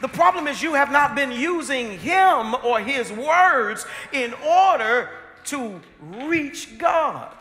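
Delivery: loud, high, emphatic sermon delivery; impassioned preacher at a peak; full-force preaching. Real, not acted. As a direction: drive each phrase home slowly and forcefully.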